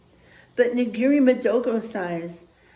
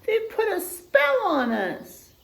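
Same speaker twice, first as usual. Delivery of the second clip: high, slower alarmed cry; alarmed animals; 11 semitones higher, slower than usual. Acted. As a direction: warn of the spell. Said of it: "cried the other animals".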